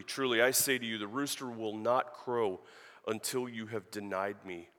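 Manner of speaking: a preacher reading John aloud, solemn; scripture reading. Acted. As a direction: read the passage with weight. Reads the gospel passage.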